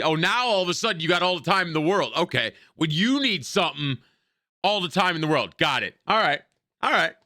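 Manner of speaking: high, indignant sarcastic rant; sarcastic, put-out acknowledgement, rising in pitch; sarcastic indignation. Real, not acted. Mocking the character's sudden request.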